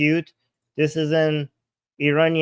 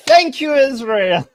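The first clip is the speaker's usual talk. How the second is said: higher, louder, slower joyful grandma voice; delighted grandma; 9 semitones higher, 6 dB louder than usual. Retold or imitated. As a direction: thank them like an overjoyed old grandma. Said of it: "She's like".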